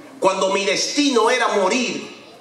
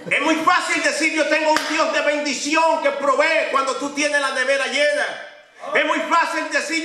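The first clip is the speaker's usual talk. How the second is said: higher, fervent preaching; 5 semitones higher than usual. Acted. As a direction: lift the congregation with it. Preacher building his point.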